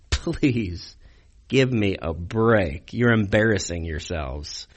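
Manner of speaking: low, soft, drawn-out disbelief; exasperated. Retold or imitated. Preacher voicing Paul fed up with the Corinth church, exasperated. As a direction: drop the voice and drag it out, fed up. Acts out Paul's exasperated reply.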